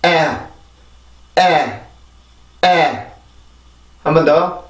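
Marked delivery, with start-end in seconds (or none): 0.1-3.1 "에" as English æ, tongue back pressed down; falling, pressed æ for "에"; isolated "에" pronounced like the a in "at"; English vowel demo. Shown as a demonstration. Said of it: "이렇게 발음을 하죠".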